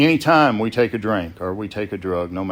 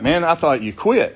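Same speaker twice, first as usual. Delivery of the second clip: raised, louder surprised question; man, you quit?; 9 semitones higher, 5 dB louder than usual. Retold or imitated. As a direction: caught off guard to hear his voice. Surprised at who answered.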